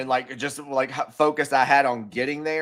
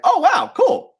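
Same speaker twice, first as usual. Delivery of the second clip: much higher, louder squeal of delight; thrilled; 8 dB louder, slower than usual. Retold or imitated. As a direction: gush like a starstruck fan meeting a stranger. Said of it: "and I was like".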